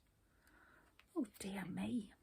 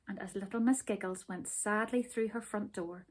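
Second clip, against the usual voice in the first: usual speaking voice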